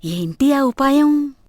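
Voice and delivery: slow, eager hushed asking; eager question. Acted. Mother-in-law asks daughter-in-law about the plan, slow and eager. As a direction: lean in hungry for the plan. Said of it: "ఆశగా ... అడిగింది".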